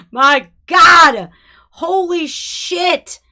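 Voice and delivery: loud stunned excited shout. Real, not acted. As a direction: blurt it out, floored. Reacting to the hot performer.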